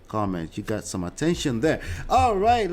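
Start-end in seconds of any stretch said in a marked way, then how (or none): 2.1-2.7 much higher, louder "All right" to reset; energetic "all right". Real, not acted. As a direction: bounce back into the show. Jumps back to the show.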